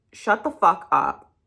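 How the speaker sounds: ordinary talk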